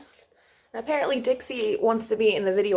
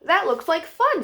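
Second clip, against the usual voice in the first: Mister Fish; 6 semitones higher, 4 dB louder than usual. Acted. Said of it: "said Mister Fish".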